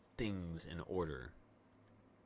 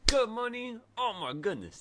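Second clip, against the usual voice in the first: excited; 7 dB louder than usual. Real, not acted. Celebrating the day's trading money.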